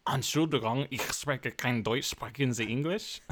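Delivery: rehearsed tourist German; German phrasebook line, German sounds played up; imitating a German accent. Shown as a demonstration. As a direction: traveler putting on a German accent. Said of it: "trying to say them how they would sound".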